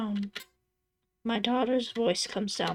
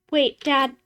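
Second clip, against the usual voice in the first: high, loud call to stop him; 5 semitones higher, 7 dB louder than usual. Acted. Daughter calls out to her father before he hangs up, loud and high.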